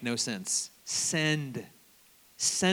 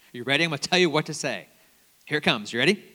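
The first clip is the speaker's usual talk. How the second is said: ordinary delivery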